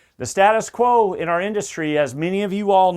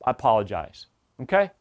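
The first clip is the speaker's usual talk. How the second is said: low, gentle apology; soft apologetic voice; 8 semitones lower than usual. Shown as a demonstration. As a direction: say it softly, sincerely sorry. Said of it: "So I'm gonna use a different voice".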